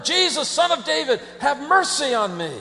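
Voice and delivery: loud pleading call, pitched high. Acted. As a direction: shout for mercy over the hush. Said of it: "cried out all the louder".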